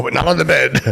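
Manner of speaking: punchline. Retold or imitated. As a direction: land the gag on the bed. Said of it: "I do that".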